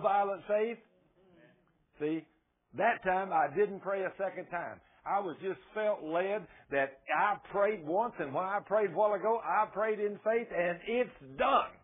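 ordinary talk, nothing marked

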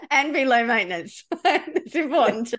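laughing as you add the rule. Real, not acted. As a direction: joke through a laugh at yourself. Joking along about her inner part.

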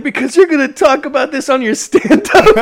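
high, loud mock wail; imitated crying girlfriend. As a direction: whine it through pretend tears, way up high. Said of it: "like, crying".

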